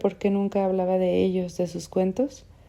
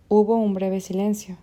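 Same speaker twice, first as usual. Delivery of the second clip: ordinary delivery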